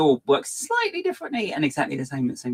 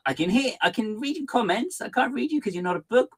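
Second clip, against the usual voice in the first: high silly voice; 12 semitones higher than usual. Real, not acted. Playful literal reply to chat.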